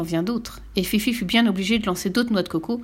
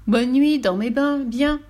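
mocking goodnight; 5 semitones higher, 4 dB louder, slower than usual. Fifi mocks the soaked men.

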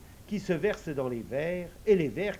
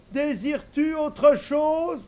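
much higher, louder eager servant voice; invisible servant; 12 semitones higher, 9 dB louder, slower than usual. Acted. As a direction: answer the master high and ready. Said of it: "demande la voix".

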